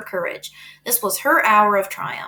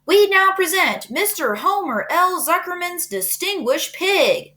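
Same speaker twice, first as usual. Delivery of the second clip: grand announcer; 9 semitones higher, slower than usual. A narrator's reading. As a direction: proclaim it like a ringmaster. Loudspeaker presents the pig.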